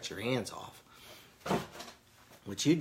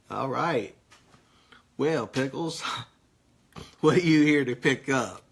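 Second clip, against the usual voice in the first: 1 mock clerk teasing; 4 semitones higher, 8 dB louder than usual. Acting a clerk mocking Pickles.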